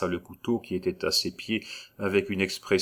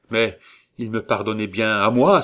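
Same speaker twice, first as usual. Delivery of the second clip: louder, indignant outcry; exclaiming; 3 semitones higher, 6 dB louder than usual. A narrator's reading. Gaston protesting to the regent, exclaiming loudly. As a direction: cry it out in protest. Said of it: "s'écria Gaston".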